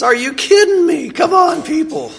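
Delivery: like you want to shake them awake; exasperated outburst. Pretend. Jesus shaking people who won't believe, exasperated. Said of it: "wanna grab us and shake us".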